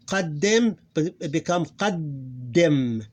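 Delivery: qaddim drawn out slowly as qad, then dim; two closed syllables. Shown as a demonstration. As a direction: split qaddim into two long syllables, holding the d. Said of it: "two syllables here".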